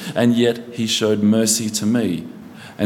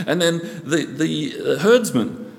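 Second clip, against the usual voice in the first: ordinary talk, nothing marked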